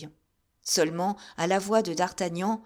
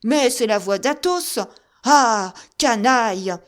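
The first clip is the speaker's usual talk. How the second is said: loud, raised, enraged shout; furious outcry; 4 semitones higher, 10 dB louder than usual. A narrator's reading. Musketeer raging at soldiers attacking his friend. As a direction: explode at the soldiers. D'Artagnan recognizes Athos, enraged.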